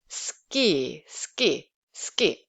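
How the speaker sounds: "Ski" with unaspirated k after the s; tense k after s. Shown as a demonstration. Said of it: "when you say like a S sound".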